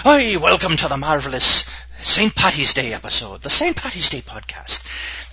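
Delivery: hammed-up Irish lilt, a bit Scottish; fake Irish accent. Greeting listeners on Saint Patty's Day in a hammed-up Irish accent. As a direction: put on a bad Irish brogue. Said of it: "That's more of a Scottish accent there".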